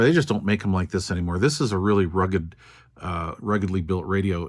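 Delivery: usual speaking voice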